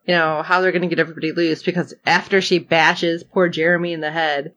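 ordinary delivery

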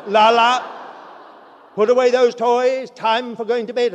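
put-on loud, high call to a child; mother calling out. Mother calling her son to bed, loud and high. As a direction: call him loudly from the next room. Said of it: "then she would say".

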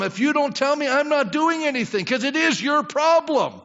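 high, insistent mock complaint to God; emphatic handing-over. Pastor praying in a difficult situation, boldly insistent. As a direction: push the burden firmly back upstairs.

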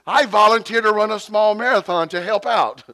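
high, loud mock announcement; hammed-up teen jumping at an escape; desperate volunteering. Teenager volunteering to get away, loud high mock eagerness. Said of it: "He just wanted to leave".

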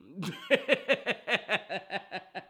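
short amused laugh. Real, not acted. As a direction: chuckle at the idea of the bet. Laughs at the bet.

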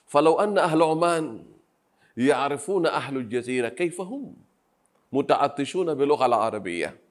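usual speaking voice

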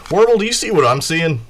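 loud, high-pitched call to a companion; Kai. Acted. Kai to Warble, standing before the car, calling out amazed. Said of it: "and he's like".

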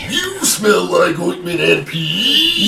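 very high, loud drawn-out yell; mock wail. Co-host topping the last take of the insult, high mock wail. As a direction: screech the taunt slowly, hammed-up. Hosts trade louder takes.

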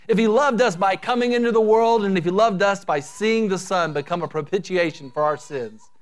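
ordinary delivery

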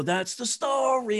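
heel traag en hoog uitgesproken slotzin; slow punchline. Retold or imitated. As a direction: rek de woorden breed uit. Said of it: "And I go".

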